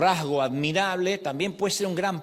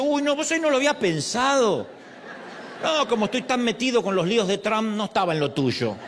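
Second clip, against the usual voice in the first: laughing mock reply; 6 semitones higher than usual. Mock God voice, joking.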